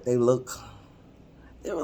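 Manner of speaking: usual speaking voice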